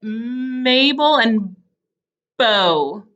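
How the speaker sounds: long hummed M in MABEL, short B in bow; slow Mabel and bow, onsets exaggerated; contrasted first sounds. Shown as a demonstration. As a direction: hold the m of Mabel, pause before bow. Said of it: "but it's still different".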